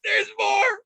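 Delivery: put-on very high, squeaky little-kid voice; gleeful toddler. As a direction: squeal it like a proud three-year-old.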